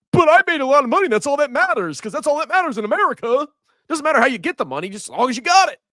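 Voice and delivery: high, fast smug scammer voice; mock greedy scammer voice; mocking crypto scammer. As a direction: parrot a greedy scammer's excuse.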